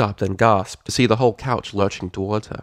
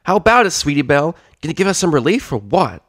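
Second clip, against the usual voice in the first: fast, louder needling filly voice; nagging filly; 8 semitones higher, 5 dB louder than usual. Acted. Scootaloo prodding Sweetie Belle to help, fast and loud. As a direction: needle a lazy friend.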